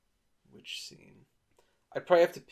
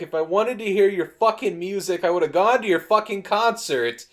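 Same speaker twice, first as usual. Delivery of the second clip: louder, higher, furious retort; angry yell; 7 semitones higher, 8 dB louder than usual. Retold or imitated. Jeff yelling at Pony to stop playing, angry. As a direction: bite every word back at him.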